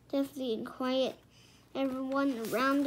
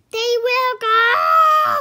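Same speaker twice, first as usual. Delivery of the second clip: very loud, high, drawn-out shout; booming; 11 semitones higher, 16 dB louder, slower than usual. A narrator's reading. Knight overruling the king, booming shout. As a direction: boom it so it echoes. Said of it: "boomed the knight".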